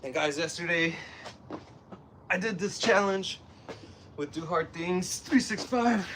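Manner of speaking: short phrases pushed out between reps; real exertion, puffing between phrases; out of breath. Real, not acted. Talking while doing burpees.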